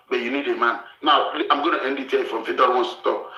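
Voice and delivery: ordinary delivery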